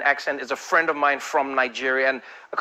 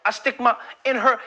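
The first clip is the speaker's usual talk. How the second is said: rapper; 7 semitones higher than usual. Acted. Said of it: "rapper's up there and he's like".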